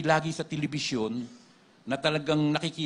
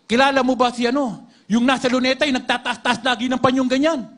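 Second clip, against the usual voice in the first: asked higher and louder; pressing question; 7 semitones higher, 6 dB louder than usual. Retold or imitated. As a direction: call out the question to the possessed man. Pastor questioning the possessed man. (malakas na pagtatanong)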